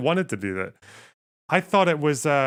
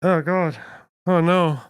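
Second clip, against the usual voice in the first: higher, louder groan of dismay; dismayed exclamation; 5 semitones higher, 5 dB louder, slower than usual. Real, not acted. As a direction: react as if something awful just popped up. Streamer reacts to chat donation.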